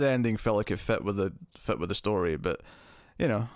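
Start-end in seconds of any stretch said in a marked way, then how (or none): none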